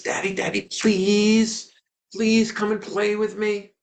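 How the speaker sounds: put-on desperate child. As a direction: plead with big eyes, pulling harder. Son pulls harder, big eyes.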